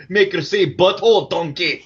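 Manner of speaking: Scottish ogre voice; Shrek impression. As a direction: ham it up like Shrek. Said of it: "sounds like Shrek".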